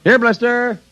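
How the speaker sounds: high calling out; father calling for the dog. Acted. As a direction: call the dog from the yard.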